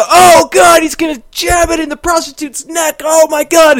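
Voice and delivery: hammed-up panic shout at the TV; shocked shout. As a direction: scream it like the horror-movie moment. Retelling a shocked viewing moment.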